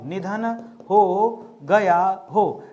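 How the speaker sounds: very slow, spaced-out words; word-by-word dictation. Shown as a demonstration. Teacher finishes a definition for students, slow dictation. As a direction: stretch and separate each word for note-takers.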